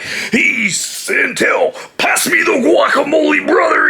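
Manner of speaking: hammed-up Macho Man demand; Macho Man promo voice. Shown as a demonstration. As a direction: bellow it like a wrestling promo. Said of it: "he wasn't doing all that, like".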